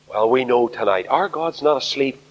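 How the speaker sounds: ordinary talk, nothing marked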